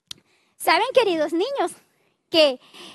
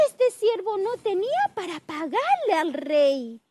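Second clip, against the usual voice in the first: ordinary talk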